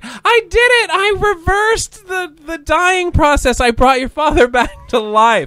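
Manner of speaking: very high, loud, drawn-out proclamation; mock triumph. Imitating scientist friend announcing revived father, ecstatic. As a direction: crow like a triumphant mad scientist.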